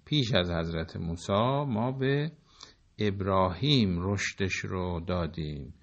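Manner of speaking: normal talking voice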